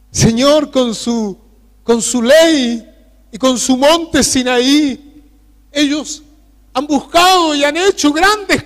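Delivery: high, loud fervent preaching cry; preaching at full force. Real, not acted. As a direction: lift the prayer into a full-throated sermon. Prayer rises to full preaching.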